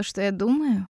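ordinary delivery, nothing marked

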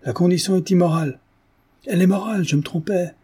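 high-pitched, agitated correction; worked-up, emphatic protest; flustered insistence. A narrator's reading. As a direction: blurt out the hasty reversal. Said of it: "qui en avait chaud".

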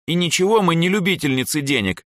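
high-pitched, huffy retort; offended. A narrator's reading. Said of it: "обиженно буркнула Эльза".

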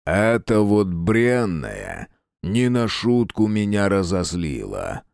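slow menacing villain rumble; dark lord. Acted.

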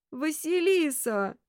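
high, slow, pleading wail of the name; plaintive call. A narrator's reading. Detective pleading with the girl lying lifeless, plaintive. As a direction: stretch it into a pitiful lament. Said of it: "ещё жалобнее позвал Кудесников".

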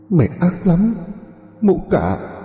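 high-pitched, vengeful ghost cry; shrieking ghost. Acted. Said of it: "Bỗng chợt cô hét lên". (tiếng hồn ma hét đòi mạng)

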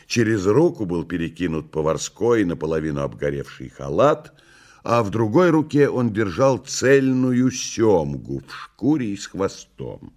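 ordinary delivery

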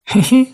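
very high tittering "hee-hee"; storybook mouse's high titter; acted giggle. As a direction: squeak out a tiny giggle.

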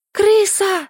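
high panicked shriek of a rat. A narrator's reading. Heroine shrieking at the rat, high panicked shriek. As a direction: scream it out, terrified. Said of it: "завизжала я не своим голосом".

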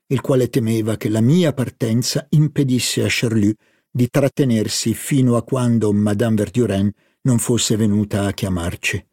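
usual speaking voice

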